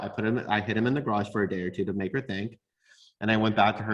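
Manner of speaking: usual speaking voice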